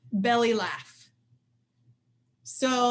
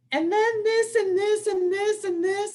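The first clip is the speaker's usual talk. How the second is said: high, louder, rapid-fire list; racing thoughts; 9 semitones higher, 3 dB louder than usual. Retold or imitated. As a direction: spiral through worries without a breath. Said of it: "in your head, you're not going".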